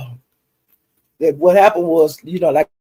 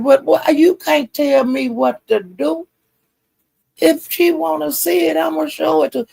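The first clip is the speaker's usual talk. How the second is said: a high, indignant retort; imitating a defiant comeback, voice much higher; mock defiant comeback; 11 semitones higher than usual. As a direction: throw it back at him, pitched well up. Retold argument, Brandon's comeback.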